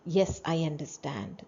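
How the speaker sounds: settled falling statement tune; teacher demo of an answer intonation. Shown as a demonstration. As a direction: answer it flatly and firmly. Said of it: "This is the answer tone".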